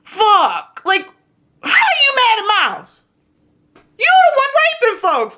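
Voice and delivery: angry mock shout. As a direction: tear into him as Miles, shouting. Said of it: "Miles is finally like".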